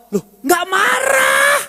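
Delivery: high, drawn-out protesting yell; comic full-voiced denial, stretched at the end; loud Batak man. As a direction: yell in protest that you are calm. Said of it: "kalau ngomong pita suara tebal, suaranya keras".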